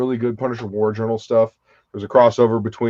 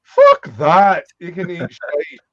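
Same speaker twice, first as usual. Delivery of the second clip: higher, loud playful refusal breaking into a laugh; mock defiance; 5 semitones higher, 4 dB louder, slower than usual. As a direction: pretend to be outraged, laughing through it. Mock-defiant reply to friend's ban.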